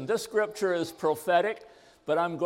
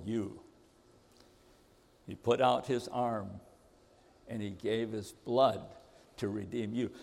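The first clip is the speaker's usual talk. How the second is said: hushed; 7 semitones lower, 7 dB quieter than usual. Acted. Altar-call moment, solemn and hushed.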